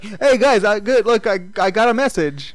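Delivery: imitating an oddball blurting things out; awkwardly loud blurting. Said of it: "anything that pops into his head, he just says".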